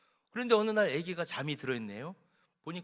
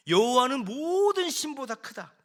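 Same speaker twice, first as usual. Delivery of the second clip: louder, higher ringing proclamation; proclaiming; 7 semitones higher, 8 dB louder than usual. Acted. Jethro praising the Lord before Moses, loud and high. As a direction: declare it to the whole hall.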